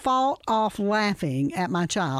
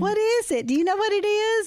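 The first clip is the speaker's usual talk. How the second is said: put-on child voice; 11 semitones higher than usual. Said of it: "they'll go".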